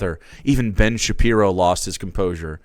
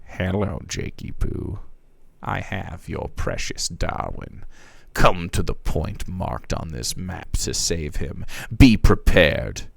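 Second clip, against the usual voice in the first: a lower, slower sinister letter voice; creepy ransom note; 4 semitones lower, slower than usual. Acted. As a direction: purr it like a lurking villain. Reading the villain's ransom letter.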